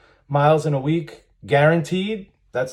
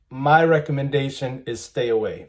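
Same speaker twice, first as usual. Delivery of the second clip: normal talking voice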